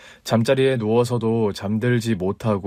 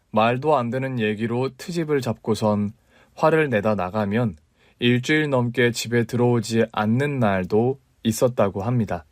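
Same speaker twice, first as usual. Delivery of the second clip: ordinary delivery, nothing marked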